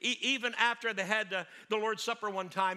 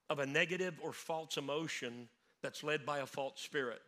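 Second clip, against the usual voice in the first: normal talking voice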